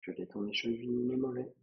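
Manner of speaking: soft, unhurried meditation voice with long pauses; guided relaxation. Acted. Yoga teacher guiding a student through a relaxing body scan, softly and calmly. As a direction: lead a quiet body scan, phrase by phrase.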